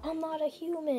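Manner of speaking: high mock yell at ordinary volume; retold yell. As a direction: imitate a yelled protest, pitch jumping up. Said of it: "he just yells that out".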